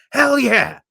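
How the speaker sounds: high, loud enthusiastic holler; hollered agreement. Retold or imitated. Audience member answering the teasing host, loud high holler. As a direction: holler it back at full voice. Retelling his shouted reply to host.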